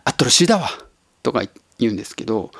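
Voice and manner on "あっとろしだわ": higher, louder show of surprise; hammed-up Tottori surprise; mock astonished exclaim. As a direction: play at being startled. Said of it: "驚いた時に使う言葉".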